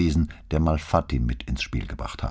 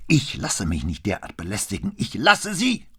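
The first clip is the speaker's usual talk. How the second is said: empört; 6 semitones higher than usual. A narrator's reading. Empörter Anwalt wirft Besucher hinaus.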